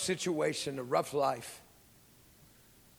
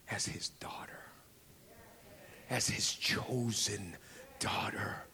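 soft, slow whisper, much quieter; hushed whisper; 7 semitones lower, 4 dB quieter than usual. Retold or imitated. Preacher whispering comfort to a hurt little girl, hushed. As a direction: lean in and whisper it tenderly. Tender whispered words to child.

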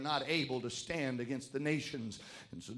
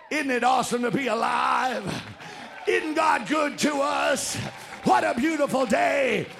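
loud, raised, declaimed cheer; exuberant rejoicing; 9 semitones higher, 7 dB louder than usual. Acted. Walking into the complainers to praise life, exuberant cheer. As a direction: burst out praising the day.

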